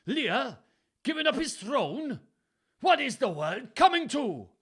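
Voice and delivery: very high, loud Welsh exclaiming; Welsh accent. A narrator's reading. Gloucester mutters to himself about the king, loud Welsh accent. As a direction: swoop up in a hammed-up Welsh accent. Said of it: "He was Welsh, wasn't he?".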